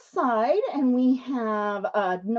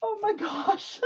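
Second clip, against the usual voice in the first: high, soft, giggly exclamation; helpless laughing; 8 semitones higher, 4 dB quieter than usual. Real, not acted. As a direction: squeak it out before dissolving into laughter. Helpless laughter after spider scare.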